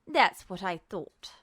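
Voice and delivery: low, quiet and smug; quiet smug villain. Acted. Justin answers Ed, low and smug. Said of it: "he said quietly".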